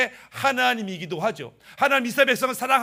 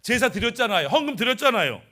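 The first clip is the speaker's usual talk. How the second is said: acted demanding challenge, pitched up; argumentative protest; 3 semitones higher, 4 dB louder than usual. People confront God over unanswered prayers, demanding an answer. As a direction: press the grievance in short insistent bursts. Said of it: "이렇게 따지는 백성들의 모습".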